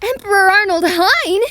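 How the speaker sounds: high-pitched, startled question; shocked recognition. Acted. Woman recognises the man she ran into, shocked recognition. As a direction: squeak the name out in alarm.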